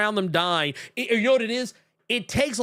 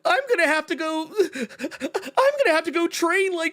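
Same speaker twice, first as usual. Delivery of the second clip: mock whining; 8 semitones higher than usual. Imitating a whiny hero dreading training, high mock whine. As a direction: moan it high, dragging the words.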